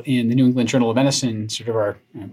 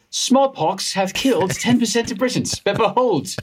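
higher-pitched mock RP English; put-on RP accent; 10 semitones higher than usual. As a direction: attempt the Queen's English in a raised voice. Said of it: "pulling in this R P accent".

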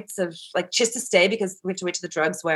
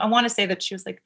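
ordinary talk

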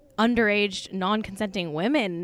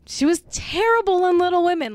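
emphatic declaration; 9 semitones higher, 6 dB louder than usual. Real, not acted. Emphatic rant to listeners.

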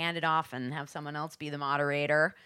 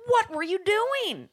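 high, slow mock-scolding mother; exasperated mom; 13 semitones higher, 4 dB louder, slower than usual. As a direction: whine it like an annoyed mom. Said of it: "my mom's like".

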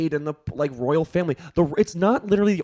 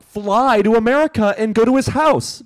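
louder, higher exasperated demand; 6 semitones higher, 5 dB louder than usual. Real, not acted. Telling Meghan what she should have done, exasperated shout. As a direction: throw up your hands and shout the advice. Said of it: "exasperated advice".

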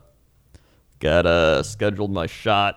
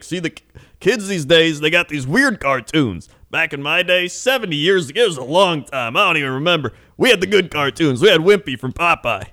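put-on old trucker; 7 semitones higher than usual. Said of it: "he looks behind the counter".

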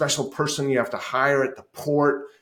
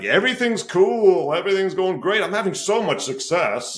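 fake cheerful front; 9 semitones higher, 5 dB louder than usual. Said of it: "bullshit facade of".

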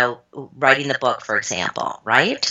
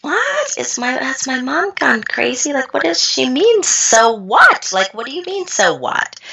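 high, incredulous what; stunned disbelief; 10 semitones higher than usual. Retold or imitated. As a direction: squeak with shocked disbelief, much higher. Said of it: "I looked at her like".

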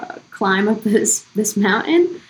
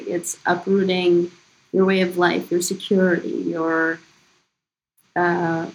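ordinary talk

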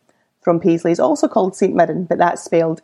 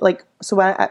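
normal talking voice

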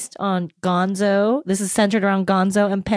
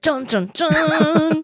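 dramatic sting; 6 semitones higher, much slower than usual. Pretend. Dramatic true crime sting.

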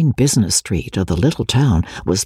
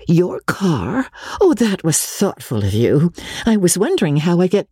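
a touch higher, grateful and a bit hurried; lightly voiced grateful lady; 6 semitones higher than usual. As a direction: thank him warmly, rushing at the end. Magnolia thanks Barnato.

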